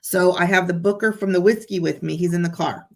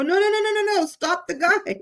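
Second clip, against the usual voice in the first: frantic calling out; 12 semitones higher than usual. Retold or imitated. Calling out to stop a car.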